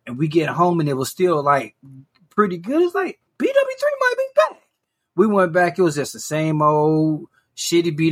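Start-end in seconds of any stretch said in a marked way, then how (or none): 3.4-4.6 much higher, mock hopeful squeak; hopeful inner voice. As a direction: play up the naive hope, pitched way up. Retold hopeful inner thought.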